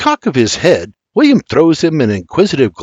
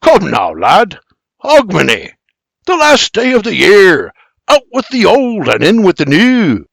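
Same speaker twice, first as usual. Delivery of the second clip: rising Scottish enthusiasm, higher; excited brogue; 8 semitones higher, 4 dB louder than usual. Acted. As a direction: sell the holiday with relish. Scot insists on Hogmanay.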